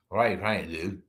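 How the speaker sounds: imitating a slurred blunt question; slurred speech. Patient asking a crying man, imitated slurred speech. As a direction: lightly slur it, as the other patient. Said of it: "And in his slurred speech".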